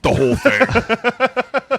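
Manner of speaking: very high and slow; drawn-out high exclamation. Acted. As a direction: stretch it out high in disbelief.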